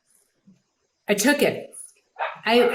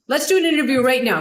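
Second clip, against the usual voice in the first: higher, quick bossy imitation; John McEnroe; 5 semitones higher, 4 dB louder, faster than usual. McEnroe demanding an interview on the spot, bossy. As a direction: order her into it impatiently. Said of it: "And he said".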